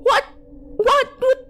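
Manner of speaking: very high, loud shriek; broken whats building to a screech; hysterical outcry. A narrator's reading. Aunt learns boy is lost, shrieking.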